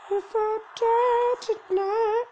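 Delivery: squeaky high whiny voice, slow; high put-on character voice. Player voicing his dying character, high and slow. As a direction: pitch way up like a cartoon victim.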